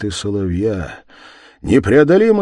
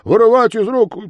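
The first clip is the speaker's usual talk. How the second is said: high, loud, hurried complaint; flustered constables; 13 semitones higher, 8 dB louder than usual. Acted. Constables explain the escape, high and agitated. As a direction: pour out the excuse in a raised voice. Constables excuse their failure.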